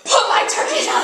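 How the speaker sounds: husband panicking over his turkey; laughing shout. Retold or imitated. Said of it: "He's like".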